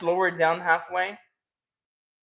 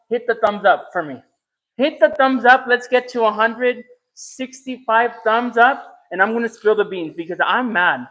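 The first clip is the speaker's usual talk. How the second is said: loud, higher, teasing mock anger; hyped host teaser; 4 semitones higher, 8 dB louder than usual. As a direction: pump up the chat like a livestream host. Hypes viewers, mock-mad bean spilling.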